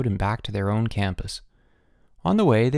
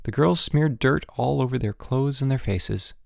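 usual speaking voice

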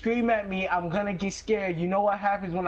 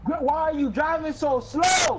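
yelling at grandma; 6 semitones higher than usual. Acted. Grandson yelling at grandma to drive faster. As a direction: raise your voice, fed up with her driving.

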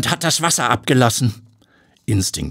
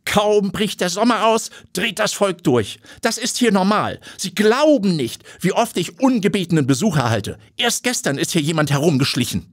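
lightly voiced snooty lady; mocking disdain; 11 semitones higher than usual. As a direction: brush the reporter off with scorn. Owner mocks the crowd.

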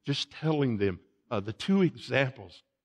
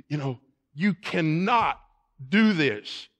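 higher, louder scolding; acted stern rebuke; 5 semitones higher, 5 dB louder than usual. King rebuking the young deserter, acted and stern. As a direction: lay down the law as king.

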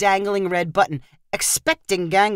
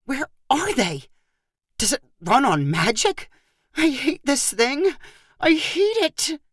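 frustrated grumbling; 6 semitones higher than usual. A narrator's reading. Megan grumbling at sealed toy.

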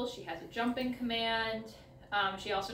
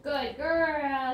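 loud praise; 4 semitones higher, 7 dB louder than usual. Real, not acted. Praising Mally after turn.

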